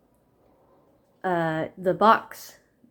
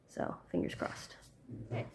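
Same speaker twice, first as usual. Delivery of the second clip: whispered; 14 dB quieter than usual. Real, not acted. Hopeful aside.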